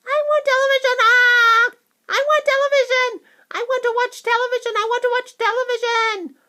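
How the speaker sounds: very high, loud shrieking; tiny boy screaming in a tantrum; squeaking tantrum. A narrator's reading. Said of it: "he squeaked".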